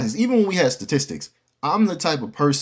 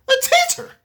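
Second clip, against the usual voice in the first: exasperated squeal; 4 dB louder than usual. Real, not acted. Exasperated outburst about teacher.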